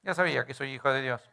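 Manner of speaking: lightly mock the know-it-all churchgoer; smug religious person. Preacher mocking a smug religious listener, lightly. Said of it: "Si sos religioso, decís".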